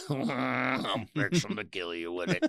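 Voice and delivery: slow, low Carl threat; Carl impression.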